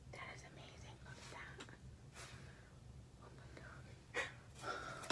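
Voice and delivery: hushed unvoiced whisper; awed whisper to the camera. Real, not acted. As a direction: breathe it softly in awe. Whispering over the nibs.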